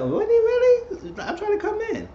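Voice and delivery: imitating Nia, very high with a laugh; mock squeaky Nia. Retelling Nia's reaction to the call, high mock squeal.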